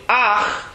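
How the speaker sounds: Ach, ah then a throaty ch; the ach ending shown alone; guttural ach. Shown as a demonstration. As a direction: scrape the ch after the vowel. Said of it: "So what are we doing?".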